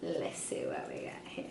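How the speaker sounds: hushed whisper to oneself. Real, not acted. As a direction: whisper it very softly while checking. Reading her slip to herself.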